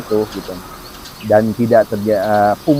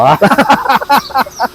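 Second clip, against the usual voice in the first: high burst of laughter; 12 semitones higher, 3 dB louder than usual. Real, not acted. Host laughs at age joke.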